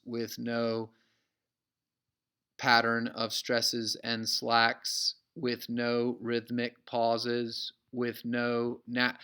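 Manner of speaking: slow, even robotic monotone; every word dragged out evenly on one note. Shown as a demonstration. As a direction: drone it with no stresses or pauses. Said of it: "If you ever heard anyone speak monotonely".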